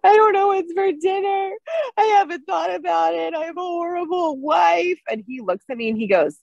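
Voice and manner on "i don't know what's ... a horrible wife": high, crying wail, half-shouted; bawling. Retold or imitated. Wife crying at husband over dinner, wailing sob. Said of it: "I started bawling".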